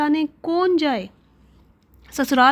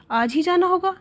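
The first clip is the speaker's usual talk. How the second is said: higher-pitched astonished question; surprised, irritated; 6 semitones higher than usual. A narrator's reading. Wife hears they must leave today, surprised and irritated. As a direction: question it with a touch of annoyance. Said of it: "आश्चर्ययुक्त होकर और झुंझलाकर बोली".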